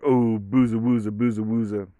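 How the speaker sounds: put-on sing-song pet voice; baby talk. Owner scratching the dog, in baby talk. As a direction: coo nonsense at a dog. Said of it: "when it comes to baby talk with my dog".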